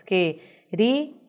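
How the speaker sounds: "queríamos" split into que, ri, a, mos; separated syllables. Shown as a demonstration. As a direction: pause after each syllable of "queríamos". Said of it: "Separando, nós teríamos". ("queríamos" separado sílaba por sílaba)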